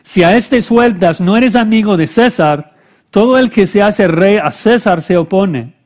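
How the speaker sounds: high, loud crowd protest; crying out. A narrator's reading. Crowd warning Pilate about Caesar, crying out. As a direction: call the accusation out forcefully. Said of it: "los judíos daban voces diciendo".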